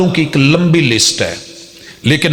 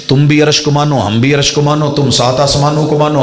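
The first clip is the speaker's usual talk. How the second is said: ordinary delivery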